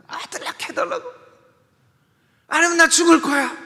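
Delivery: a wife screaming at her husband in despair; Rachel's desperate outburst. Acted. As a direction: Rachel screaming at Jacob for a child, high and loud. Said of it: "죽겠다고 하는 아내가".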